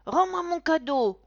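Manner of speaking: put-on high, loud furious child; roaring boy. A narrator's reading. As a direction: roar it like a raging little boy. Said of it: "rugit Quentin". (rugissement d'enfant furieux, aigu)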